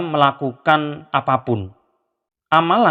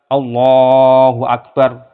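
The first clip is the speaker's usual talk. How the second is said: drawn-out, much louder Allahu Akbar; loud takbir; 6 dB louder, slower than usual. Shown as a demonstration. Healer demonstrates takbir while treating a possessed patient, slow loud cry. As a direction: cry it out slowly like a healer striking a jinn. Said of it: "Misalkan kita bacakan".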